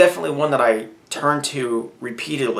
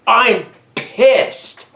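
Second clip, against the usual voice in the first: angry at God; 7 semitones higher, much slower than usual. Retold or imitated. Praying to God, a raised, pissed-off outburst. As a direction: let God know you're fed up. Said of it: "walk up to the throne room of God and say".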